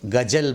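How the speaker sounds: 'गज़ल' with hard g and a j; common mispronunciation. Shown as a demonstration. Teacher quotes what people say, mispronounced. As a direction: say 'गज़ल' as gajal. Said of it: "उसको गज़ल बोलते हैं".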